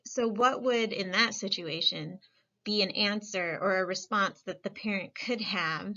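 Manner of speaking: ordinary talk, nothing marked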